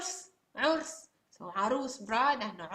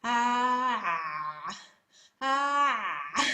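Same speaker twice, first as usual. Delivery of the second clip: loud ah squeezed through a narrowed throat; a strangled ah, hand at the throat; choked throat ah; 4 dB louder than usual. Shown as a demonstration. Teacher demonstrating hand on throat, choked ah. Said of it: "you make your throat tinier with the hand".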